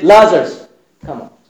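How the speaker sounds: loud call on Lazarus, then a drop. Acted. Jesus calls Lazarus out of the tomb with a loud cry. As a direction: summon the dead man by name.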